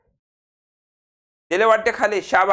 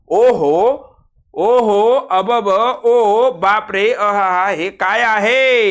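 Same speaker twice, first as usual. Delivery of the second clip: slow, louder astonished cries; hammed-up amazement; 4 dB louder, much slower than usual. Shown as a demonstration. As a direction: pile on the wonder, stretching each. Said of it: "हे आश्चर्यदर्शक".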